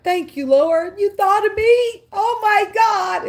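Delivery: a joyful cry up to the Lord; overwhelmed gratitude. Retold or imitated. Grateful believer to the Lord, overwhelmed joyful cry. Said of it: "makes me feel like".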